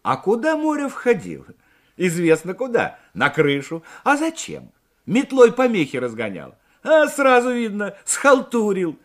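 high, loud teasing calls; joking banter. A narrator's reading. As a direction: tease a friend in front of the crowd. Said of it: "Встретили Морева шутливыми репликами". (шутливая громкая перепалка)

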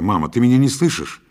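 loud, impatient insistence; exasperated outburst. A narrator's reading. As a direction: burst out, unable to hold back. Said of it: "не сдержался я".

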